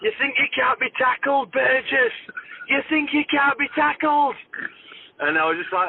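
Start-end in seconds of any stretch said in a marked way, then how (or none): 0.0-4.3 imitated shout. Player mimicking an opponent shouting after a scuffle, high mock shout. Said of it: "he started shouting at me".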